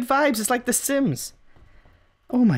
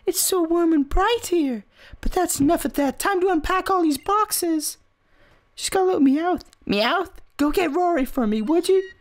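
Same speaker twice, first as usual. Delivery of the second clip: higher-pitched mom reading her lines; cheery game mom; 8 semitones higher than usual. Acted. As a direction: read it as an enthusiastic mom. Reads the game mother's dialogue.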